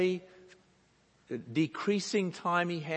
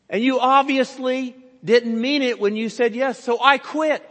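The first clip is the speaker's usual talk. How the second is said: much higher and much louder scolding; indignant telling-off; 8 semitones higher, 9 dB louder than usual. Retold or imitated. Preacher voicing the assistant quitting in outrage, loud scolding. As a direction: scold him and quit on the spot.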